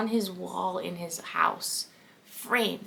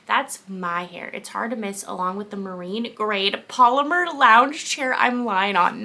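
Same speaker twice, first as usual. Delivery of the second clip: ordinary delivery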